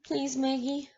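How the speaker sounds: put-on high pleading child's voice; begging child. A narrator's reading. Small boy begging at the door, high and pleading. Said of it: "begged Pippo's voice".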